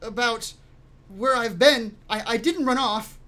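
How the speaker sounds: put-on high, stammering boy's voice; high-pitched kid, tripping over words; kid in character. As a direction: explain yourself as a rattled youngster. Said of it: "Uh, the kid says".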